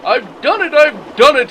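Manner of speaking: puffing with triumph; triumphant. Acted. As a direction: crow it at the top of the hill. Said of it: "he puffed triumphantly".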